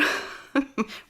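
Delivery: airless little laugh of amusement; speaker laughs recalling the comfortable tour. Real, not acted.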